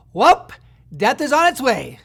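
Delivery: hammed-up doom warning; mock movie announcement. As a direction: blurt it out like an abrupt movie opening. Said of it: "jumped into a movie and it's like".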